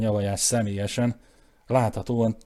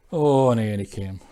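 ordinary delivery, nothing marked

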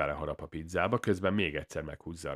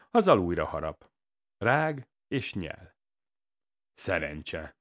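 plain reading voice

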